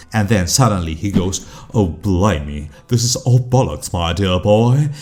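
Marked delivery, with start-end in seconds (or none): 1.8-4.9 put-on very posh British accent. Critic imagining Hugh Grant breaking cowboy character, very posh British accent. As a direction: slip suddenly into plummy posh British. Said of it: "in a very posh British accent".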